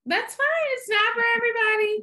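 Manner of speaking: very high, held-pitch singsong concession; mock acceptance. Real, not acted. Host conceding to unconvinced co-host, high singsong mock acceptance. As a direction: sing it out high, pretending not to mind.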